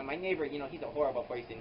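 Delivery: ordinary talk, nothing marked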